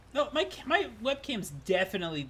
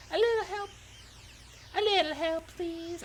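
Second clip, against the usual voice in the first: pitched-up put-on southern accent; 11 semitones higher than usual. Shown as a demonstration. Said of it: "doing a fucking southern accent".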